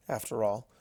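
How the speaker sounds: ordinary delivery, nothing marked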